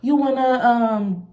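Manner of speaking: lightly voiced upbeat friend; a hint of the eager friend; friendly invite. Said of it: "she was like".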